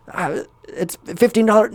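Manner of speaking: high hesitant answer; mock timid clerk. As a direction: answer scared. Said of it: "shopkeeper answers".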